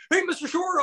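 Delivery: high, eager fan greeting; hammed-up starstruck hello; overexcited fanboy. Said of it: "so pumped".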